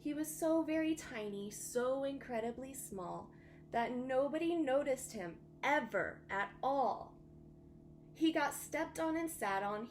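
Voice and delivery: put-on gentle rhyming read-aloud for kids; storybook reading. A narrator's reading. Teacher reading a bedtime story to her class, gentle rhyming read-aloud.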